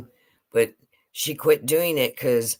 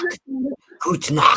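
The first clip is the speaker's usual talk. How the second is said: louder teacher-style Gute Nacht; teacher demo; 12 semitones higher, 5 dB louder than usual. Pretend. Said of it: "I thought that would be our teacher".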